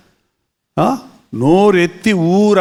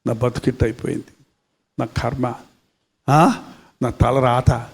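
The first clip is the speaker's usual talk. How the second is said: low, soft, self-pitying moan; mock whining lament; 9 semitones lower, 6 dB quieter than usual. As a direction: imitate someone sulking over their fate, lower and softer. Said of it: "ఏడుచుకుంటా ఉంటారు". (తక్కువ స్వరంలో నెమ్మదిగా వాపోవడం అనుకరణ)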